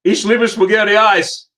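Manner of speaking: high, loud and slow mock-earnest German; big, drawn-out enthusiasm; gleeful declaration. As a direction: proudly announce your love to the officer. Acted cheeky answer to stern officer.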